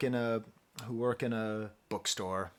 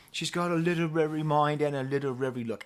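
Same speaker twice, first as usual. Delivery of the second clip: put-on British accent for a catchy lyric; 3 semitones higher, 4 dB louder than usual. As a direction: recite the hook in an amateur British accent. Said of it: "I'm doing with a British accent, not with an Irish accent".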